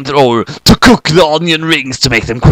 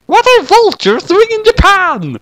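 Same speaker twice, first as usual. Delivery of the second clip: put-on much higher, slow puzzled girl; slower than usual. Kyoko puzzled by vultures, high slow girl voice. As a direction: wonder aloud in a squeaky voice.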